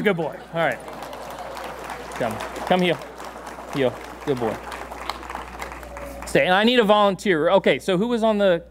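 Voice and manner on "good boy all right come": normal talking voice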